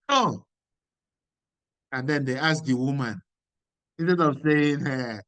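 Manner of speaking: ordinary delivery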